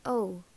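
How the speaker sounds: "o" as a long round oh; Irish o vowel. Shown as a demonstration. Teacher showing the other vowel option, long oh. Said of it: "is pronounced".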